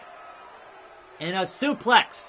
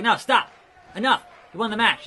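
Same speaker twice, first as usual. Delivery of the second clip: voicing the referee; 4 semitones higher, 5 dB louder, faster than usual. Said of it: "Referee's like".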